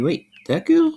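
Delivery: high, startled question; a girl suddenly recognizing a classmate; surprised gasp. Acted. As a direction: catch her off guard, pitch jumping up.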